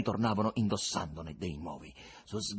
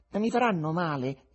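pensive question; 5 semitones higher, 4 dB louder than usual. Acted. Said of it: "chiese pensierosa".

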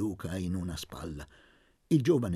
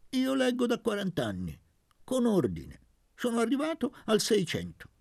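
put-on higher, lively old scholar voice; eccentric old man; 6 semitones higher than usual. A narrator's reading. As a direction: ramble proudly like a quirky old priest. Don Benedetto eccentrico parla. (vecchio prete eccentrico, voce più alta)

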